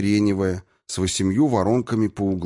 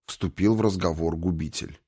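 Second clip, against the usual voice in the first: usual speaking voice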